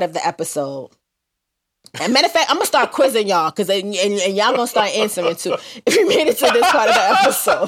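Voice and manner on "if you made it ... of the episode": playful callout. Acted. Playful repeated call to listeners.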